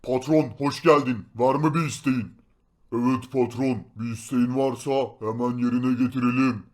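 low, steady bodyguard voice; deep guard voice. Acted. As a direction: speak low like a loyal guard. Golem guards greet boss.

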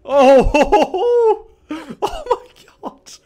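very loud, high laughing oh my god. Real, not acted. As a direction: howl with laughter, slowly. Laughing at fight.

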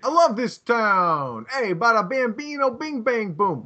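put-on drawn-out, high movie New Yorker accent; big hammed-up New York catchphrase voice. Mocking friend's movie-learned speech, broad New York accent. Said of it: "like, a New York accent".

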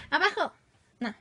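real sharp command shouting abajo; sharp call. Real, not acted. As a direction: order the cat down, firmly. Calling the cat down.

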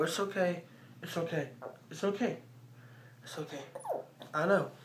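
soft, hushed reassurance; comforting the dog, barely above a breath; soothing the dog. Real, not acted.

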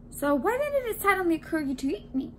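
quick, high-pitched demand. A narrator's reading. Donut questions man who tried eating him, demanding. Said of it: "Arnie demanded".

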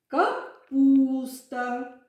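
short "ка", then lean hard and long on "пус" in "Капуста". Shown as a demonstration. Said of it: "ми вимовляємо голосніше".

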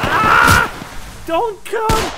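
high screaming warning; panicked yell. Real, not acted. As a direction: scream at them to stay back. Warning teammate away, screaming.

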